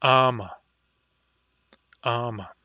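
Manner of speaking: Ama with a crisp catch before the A; Ama, clean throat catch at the start; glottal stop word. Shown as a demonstration. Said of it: "example word for glottal stop is ama".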